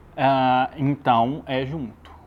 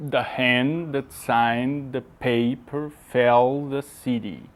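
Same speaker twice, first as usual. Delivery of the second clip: "The hand that signed the paper fell the city" slow, strong and weak syllables alternating; rise-and-fall meter; much slower than usual. Shown as a demonstration. Teacher shows a student the English verse's feet, slow rise-and-fall meter. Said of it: "o sobe e desce".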